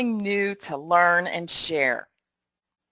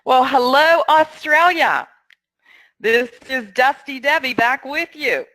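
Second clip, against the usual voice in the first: put-on terrible Australian accent; 3 semitones higher, 4 dB louder than usual. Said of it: "with my terrible Australian accent".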